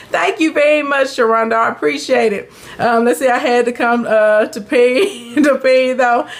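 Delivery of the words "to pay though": words breaking into laughter; cracking up at her own slip; laughing. Real, not acted. Cracks up mid-sentence.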